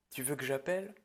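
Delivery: usual speaking voice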